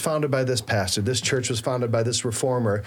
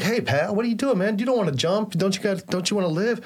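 lightly acted, higher-pitched plea; concerned bystander; 6 semitones higher, faster than usual. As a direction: talk a jumper down, pitched up, joke-telling style. Joke bystander pleading with would-be jumper.

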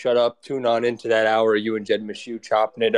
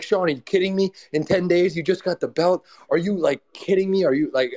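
higher, disbelieving mock protest; a lightly voiced team member gasping are you kidding; incredulous team; 7 semitones higher than usual. Team members scolding Sean for taking the fight, high and incredulous. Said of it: "his team's like".

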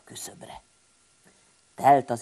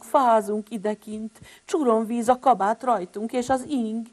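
pleading siblings; 8 semitones higher, 6 dB louder than usual. Acted. Six siblings pleading in storm.

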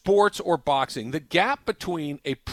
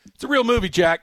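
excited outburst; 9 semitones higher, 7 dB louder than usual. Real, not acted.

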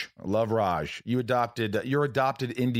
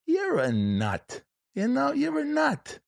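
put-on very high, slow, drawn-out old New York voice; grandfather impression; slower than usual. Grandfather sizing up his grandson, high slow impression. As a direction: stretch each word out high like grandpa. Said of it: "I could do a good impression of my grandfather".

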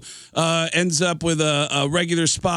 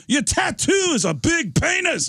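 very high, slow mock shout; exasperated shout; 12 semitones higher, slower than usual. Singer spelling it out to the drummer, exasperated shout. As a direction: spell it out at a high-pitched holler.

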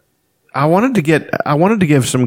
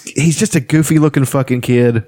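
usual speaking voice

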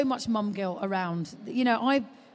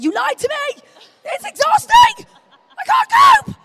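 shrieking, laughing, high-pitched mock meltdown; screeched half-laughing complaint; mock desperate yell; 13 dB louder than usual. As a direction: howl it like a frazzled new mum at breaking point. Retold panic at friends who lied.

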